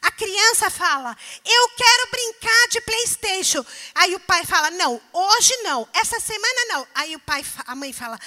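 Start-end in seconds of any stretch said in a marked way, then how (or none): 1.4-3.6 put-on high, loud demanding child. Child asks to play. 4.7-6.9 firm father. Retold or imitated. Father refusing.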